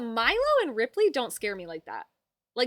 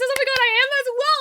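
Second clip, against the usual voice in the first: high, loud and fast; excited gush; 14 semitones higher, 10 dB louder, faster than usual. Real, not acted. Streamer gushes happily to a friend, high and fast.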